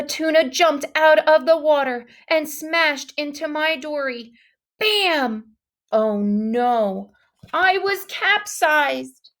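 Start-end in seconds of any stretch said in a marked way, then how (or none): none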